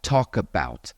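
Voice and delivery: "talk about" slow, run together as "talkabout"; linked words. Shown as a demonstration. Teacher demonstrating connected speech to the audience, slow linking. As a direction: glide "talk" straight into "about". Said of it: "connect words like".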